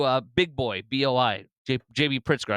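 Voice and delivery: usual speaking voice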